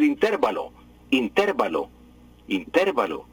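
"intervalo" stressed on TER each time; mimicked mispronunciation. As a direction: repeat "intervalo" as in-TÉR-va-lo. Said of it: "les da por decir intervalo".

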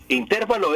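"intervalo" with a high stress on TER; mistaken stress. Shown as a demonstration. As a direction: say "intervalo" as in-TÉR-va-lo. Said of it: "intervalo es incorrecto".

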